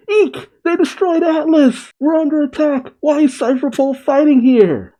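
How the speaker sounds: high-pitched panicked researchers fleeing; panicked cries. Acted. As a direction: cry out in alarm, high and hammed-up. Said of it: "with them crying".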